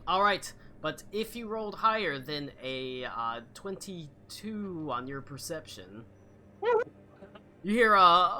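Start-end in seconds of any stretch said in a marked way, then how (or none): none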